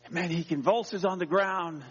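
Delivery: ordinary delivery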